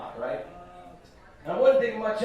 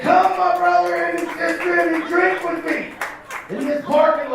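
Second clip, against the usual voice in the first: Jesus-like proclamation; 11 semitones higher, 8 dB louder than usual. Retold or imitated. Comedian to homeless men, booming Jesus-style invitation. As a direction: call the bums to drink like a prophet. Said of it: "compare myself to Jesus".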